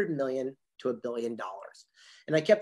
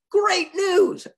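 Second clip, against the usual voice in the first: excited; 9 dB louder than usual. Retold or imitated. Billionaire's excited phone news.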